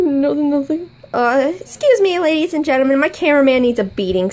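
ordinary talk

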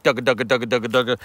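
low dugga dugga chatter; feeder chuckle imitation. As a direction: mimic a feeding duck's chuckle. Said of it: "they're like".

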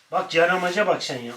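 usual speaking voice

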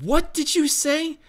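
shocked the bird can talk; startled. Acted. Mike stunned the raven spoke English, startled cry. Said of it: "Mike cursed and said".

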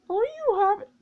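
high singsong no you haven't; taunting denial. Real, not acted. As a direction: tease him with a high denial.

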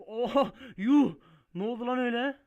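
loud, high yelps of disbelief; shocked outburst. Real, not acted. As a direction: blurt it out in sudden shock. Tır oyunda aniden devriliyor. (şaşkınlıkla bağırarak)

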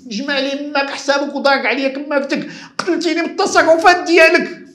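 high-pitched rant building to a shout; acted outburst, exploding on the last words; furious boss. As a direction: boss at the end of all patience. Boss sacks employee, at climax.

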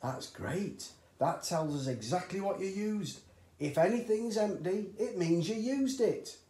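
high, loud, delighted; delighted dad. A narrator's reading. Father delighted to his son, high and loud. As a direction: crow over the empty bottles.

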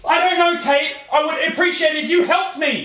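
high, flustered retort; agitated protest. Pretend. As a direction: plead for help in feigned stress. Pretend panic, snapping at partner.

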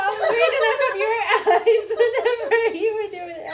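loud, high, rushed words through laughter; real hysterical laughing. Real, not acted. Explaining reading from partner's eyes, laughing hard. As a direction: gush it out while cracking up.

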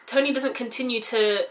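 normal talking voice

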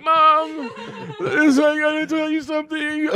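very high, nervous voice; mock panicked confession. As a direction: squeak it out like a scared kid confessing. Confessing to his mom.

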